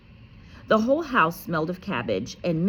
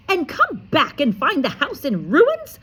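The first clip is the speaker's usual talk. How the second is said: high, loud snarl; loud high snarling aunt; 10 semitones higher, 7 dB louder than usual. A narrator's reading. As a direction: snarl it at the boy. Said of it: "she snarled".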